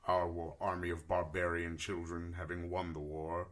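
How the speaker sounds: low, measured tale-telling in character; solemn war-orphan adventurer. Acted. Player tells character's backstory.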